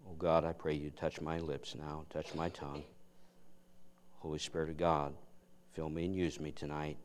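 soft, hushed pulpit prayer. Acted. Preacher praying softly over his sermon before the congregation. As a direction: pray quietly and earnestly before preaching.

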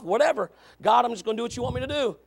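ordinary delivery

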